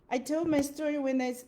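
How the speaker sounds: normal talking voice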